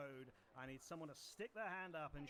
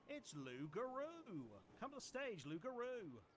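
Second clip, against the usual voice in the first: announcer call; 7 semitones higher, slower than usual. Acted. Announcing the first lot.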